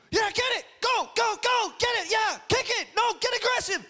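high-pitched sideline yelling; screaming. Retold or imitated. Dad at his son's soccer game, screaming him on. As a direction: yell urgent, rapid-fire commands from the touchline. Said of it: "I start screaming".

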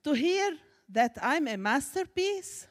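ordinary delivery

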